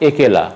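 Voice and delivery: একেলা starting with closed "e"; closed initial vowel. Shown as a demonstration. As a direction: say একেলা as "ekela". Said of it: "একেলা হবে না".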